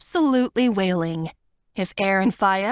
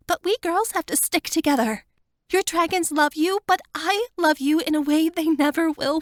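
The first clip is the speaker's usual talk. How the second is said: higher, tearful plea to her friend; Wanda's voice; 7 semitones higher than usual. A narrator's reading. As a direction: voice her pleading, higher and fuller. Wanda declares sisterly love.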